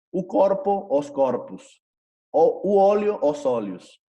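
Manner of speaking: high singular corpo, then corpus dropping to "us"; plural O as U. Shown as a demonstration. Teacher drills singular then plural, corpus and oleus ending in U. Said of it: "ya se lee como un poquito".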